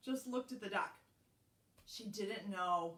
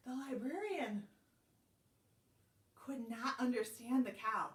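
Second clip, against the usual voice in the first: usual speaking voice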